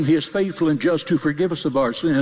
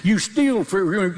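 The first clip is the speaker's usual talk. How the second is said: ordinary talk, nothing marked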